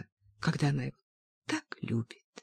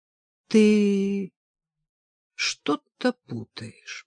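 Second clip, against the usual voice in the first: slowly; 3 semitones higher, much slower than usual. A narrator's reading. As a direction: stretch each word as he speaks slowly. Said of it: "продолжал он медленно". (медленно, растягивая слова)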